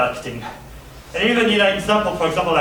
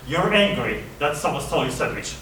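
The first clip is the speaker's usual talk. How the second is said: ordinary delivery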